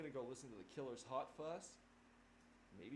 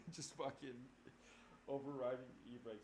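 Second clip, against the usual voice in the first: usual speaking voice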